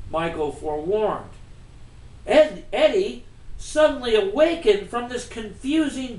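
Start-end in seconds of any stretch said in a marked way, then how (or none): none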